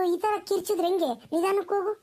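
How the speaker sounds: ordinary talk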